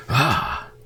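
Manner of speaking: sudden high yelp; startled gasp. Real, not acted. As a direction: jump at the scare. Jump scare reaction.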